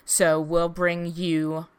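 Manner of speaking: usual speaking voice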